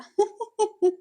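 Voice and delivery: loud, high burst of laughter; sudden laugh. Acted. Wing's mother suddenly laughs at the family tradition, loud high laugh. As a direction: burst out laughing all at once. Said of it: "अचानक हंस पड़ी".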